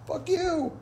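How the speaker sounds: high annoyed retort; mock snap. Annoyed comeback to Mark, high. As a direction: snap back at the joke. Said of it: "And I was like".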